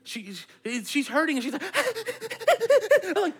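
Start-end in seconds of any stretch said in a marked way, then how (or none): 1.7-3.1 high, gasping imitation of a hurt child; mock hyped-up panting. Hurt daughter getting herself all hyped up, mock hysterical gasps. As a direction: pant and yelp in mock hysterics. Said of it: "getting herself all hyped up".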